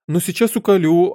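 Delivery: high, agitated and climbing; rising alarm. A narrator's reading. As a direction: work yourself up towards a scream. Queen building up to scream. (с нарастающей паникой, высоким голосом)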